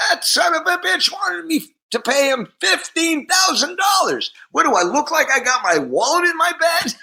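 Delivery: very high, mimicked indignant tirade; acted-out sputtering outrage; outraged rant. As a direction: rail in a strained high voice, as an offended star. Acts [name]'s outraged rant about money.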